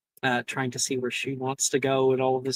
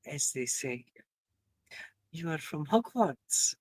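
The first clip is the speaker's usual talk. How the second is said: put-on French accent; 11 dB quieter than usual. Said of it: "a little bit of an accent".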